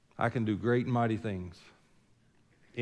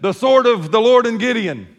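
a big shout, high and loud, like an army charging; hammed-up battle shout from the pulpit; battle cry; 10 semitones higher, 13 dB louder than usual. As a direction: roar it as a war cry. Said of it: "make a lot of noise and said".

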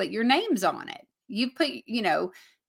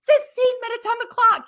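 much higher, urgent time call; excited; 13 semitones higher, 5 dB louder than usual. Retold or imitated. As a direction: call it out like a countdown. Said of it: "and I'd be like".

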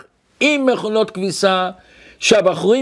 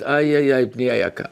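sorrowful mother; 7 semitones lower, faster than usual. Retold or imitated. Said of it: "אמא אומרת".

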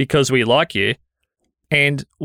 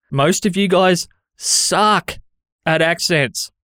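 slow, higher, every word weighed; slow emphatic verdict; 5 semitones higher, slower than usual. Real, not acted. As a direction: drag it out slowly for a teasing jab. Blunt verdict on listeners' accents.

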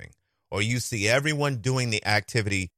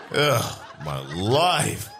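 dramatic sigh; much slower than usual. Acted. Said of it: "comic lament catchphrase".

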